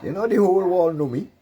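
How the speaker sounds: high, loud gloating to the opposition; boastful rant. Acted. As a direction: crow it at the opposition, pitch way up. Bragging about fame.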